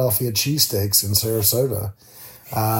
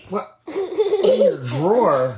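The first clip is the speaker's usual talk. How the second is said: laughing disbelief; 7 semitones higher, much slower than usual. Real, not acted. Marshmallow drawer discovered.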